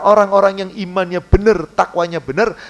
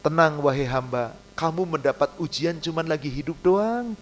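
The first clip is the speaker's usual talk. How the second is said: softer, lower, calm reassurance; soothing divine voice; 4 semitones lower, 8 dB quieter than usual. Acted. God answering a tested servant, softly reassuring. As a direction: gently reassure the suffering servant.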